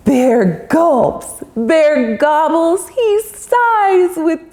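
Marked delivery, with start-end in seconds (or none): none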